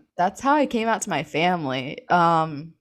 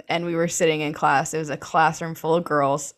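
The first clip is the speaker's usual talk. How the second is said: normal talking voice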